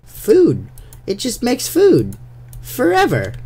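high, loud, gleeful sales pitch; excited showing off. Pretend. Player showing viewers his new food machine, gleeful. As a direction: gush over the machine like a proud kid.

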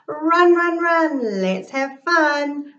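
high, loud sing-song call; excited chant to race. Acted. Child calling out to animal.

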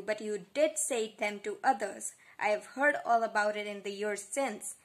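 loud, insistent rebuttal; accusing. A narrator's reading. Contradicting Alistair's denial in a quarrel, accusing. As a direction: throw the denial back at them.

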